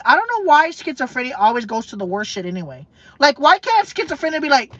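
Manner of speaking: ordinary talk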